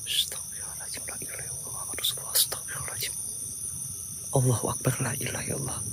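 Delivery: frightened whispered dhikr; whispered prayer. Real, not acted. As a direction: breathe the prayer out in fear. (doa berbisik ketakutan)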